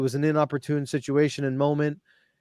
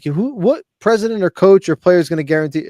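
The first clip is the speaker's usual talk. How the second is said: higher, louder rhetorical challenge; incredulous question; 5 semitones higher, 6 dB louder than usual. Retold or imitated. Host scoffing to a friend at the demand, incredulous.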